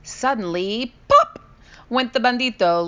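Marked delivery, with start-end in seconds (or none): 1.1-1.4 very high, loud pop; balloon bursting; popping sound. A narrator's reading. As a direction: burst the word out. Bandito bursts.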